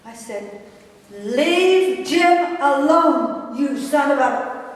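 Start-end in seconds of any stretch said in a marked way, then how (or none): 1.1-4.6 retold angry outburst. Sister pushing her bullying brother in the chest, angry. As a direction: lose your temper, stretching out the command. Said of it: "I was so angry".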